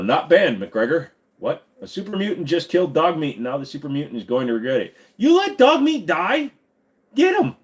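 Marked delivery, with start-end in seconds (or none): 5.2-7.5 high, loud, slow mock outrage; hammed-up horror that the dog died. Outraged at dog's death.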